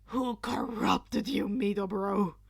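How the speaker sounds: lightly voiced shocked friend; a touch of a loud scandalized buddy. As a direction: gasp it out, scandalized.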